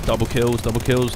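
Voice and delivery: loud, hyped shout of the kill count; excited callout. Real, not acted. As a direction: call it out like you just clutched it. Hyped gamer calling out kills.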